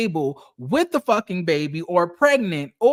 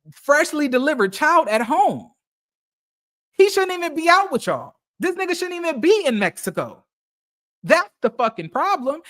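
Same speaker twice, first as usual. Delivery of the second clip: heated rant; 5 semitones higher than usual. Real, not acted. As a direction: call him out, voice climbing in disbelief. Ranting at the boyfriend's choices.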